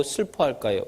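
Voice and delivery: usual speaking voice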